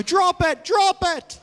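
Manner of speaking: high-pitched mock urging; hammed-up panicky order; urgent command. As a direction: urge it twice, high and insistent. Acts urgent command about toilet roll.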